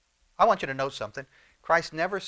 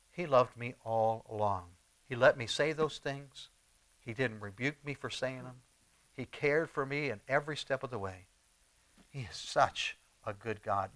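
softer, lower, wondering testimony; quiet gratitude; 3 semitones lower, 6 dB quieter than usual. Acted. Preacher voices witnesses realizing His care, hushed gratitude. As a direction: let the realization sink in softly, slowing at the end.

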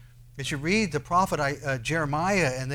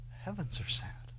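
hushed sorrow; 3 semitones higher, 10 dB quieter than usual. Acted. Repeats the sorrowful line.

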